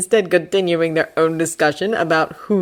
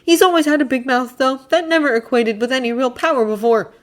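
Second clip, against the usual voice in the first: Draco voice; 9 semitones higher than usual. Acted. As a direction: promise it with a sneer.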